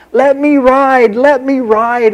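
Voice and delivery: put-on high, loud call of an eager little boy; boy yelling. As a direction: shout to the passing riverboat. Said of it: "he began to yell".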